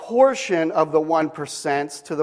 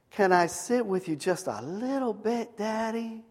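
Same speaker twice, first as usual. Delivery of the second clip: put-on little girl voice; 4 semitones higher, 7 dB quieter than usual. Child daughter voiced in story.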